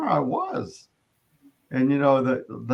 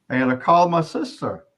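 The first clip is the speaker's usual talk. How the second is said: usual speaking voice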